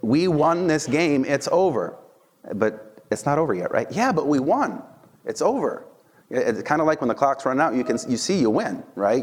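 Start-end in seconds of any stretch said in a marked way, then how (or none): 0.0-2.0 gloating. Retold or imitated. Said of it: "I think it's just like". 3.9-5.8 gloating. Retold or imitated. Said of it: "I think it's just like".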